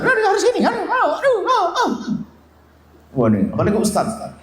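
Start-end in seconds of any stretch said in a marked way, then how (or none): 0.0-1.9 mock harsh scolding, pitched high; harsh old self. Preacher mimics how he once scolded his kids, high mock scold. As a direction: send up how you used to snap.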